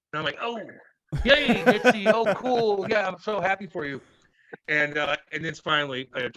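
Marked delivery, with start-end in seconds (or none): 0.4-4.0 high, loud, over-bright mock congratulations; fake happiness. Congratulating the teammate drafted ahead of him, high forced cheer. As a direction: put on forced delight for a teammate.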